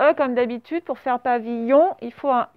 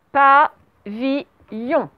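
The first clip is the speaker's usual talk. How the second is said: PAVILLON very slow, each syllable set apart; syllable-by-syllable dictation; much slower than usual. Shown as a demonstration. Teacher dictating the last word to pupils, syllable by syllable.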